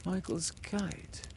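slow, soft, uninterested; boy glancing at the kite; without interest. A narrator's reading. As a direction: dismiss it. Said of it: "without interest".

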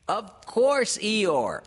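high, very loud squeaky voice; piercing eager cry; Piglet. A narrator's reading. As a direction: shout assurance in a little piping voice. Piglet reassures loudly.

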